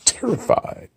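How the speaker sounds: high whispered terrified; mocking the guest as terrified. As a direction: squeak it like a frightened caricature. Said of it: "like Amy Cooper, terrified".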